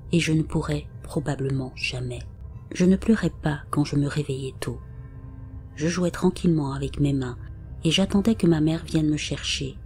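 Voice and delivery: usual speaking voice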